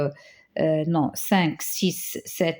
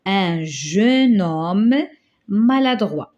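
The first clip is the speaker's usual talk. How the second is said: slow dictation; 3 dB louder, slower than usual. Shown as a demonstration. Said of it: "qu'est-ce qu'on va dire".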